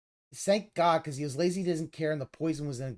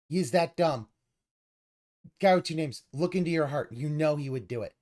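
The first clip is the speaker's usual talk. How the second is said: ordinary talk, nothing marked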